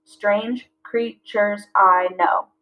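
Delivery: slow, each syllable spaced out; slow syllable-counting read. Shown as a demonstration. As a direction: clap out each beat. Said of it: "back to five syllables".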